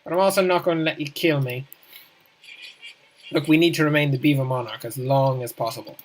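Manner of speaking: ordinary talk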